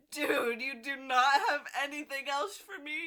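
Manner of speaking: high, loud whiny plea with a sob; mock whine. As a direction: beg the author like a wronged fan. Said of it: "and I was like".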